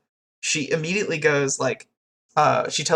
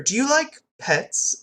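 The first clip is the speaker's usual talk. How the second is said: pitched-up, drawn-out sly question; mock-innocent query, higher and slower; needling fake innocence; 10 semitones higher, slower than usual. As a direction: ask it sweetly to wind someone up. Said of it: "to fuck with her".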